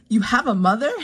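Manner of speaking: imitating a baffled, eager little son; mock childlike astonishment; so much enthusiasm. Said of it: "so much enthusiasm".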